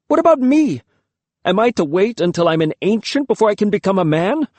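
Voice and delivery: high, loud anguished plea; shrill protest. A narrator's reading. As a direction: cry out against the unfairness.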